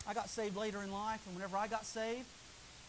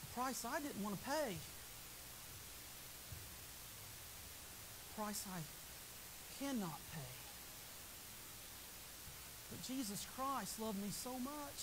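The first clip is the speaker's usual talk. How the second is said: hushed reflection; 9 dB quieter than usual. Real, not acted. Preacher going quiet about the price paid. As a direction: drop it down to a near hush.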